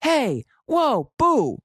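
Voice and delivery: high mock yell of hey whoa boo. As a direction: yell it high to shoo an animal. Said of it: "they mean, like, yell".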